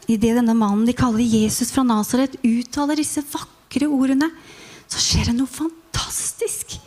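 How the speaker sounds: ordinary delivery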